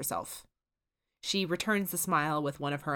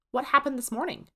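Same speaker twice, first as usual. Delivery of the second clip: put-on higher boyish wondering; wondering Dipper; 9 semitones higher, 4 dB louder than usual. A narrator's reading. Dipper asks Susan about the morning, higher and curious. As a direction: ask it high, puzzled. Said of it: "wonders Dipper".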